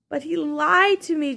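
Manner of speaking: weeping. Acted. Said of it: "Jonas wept".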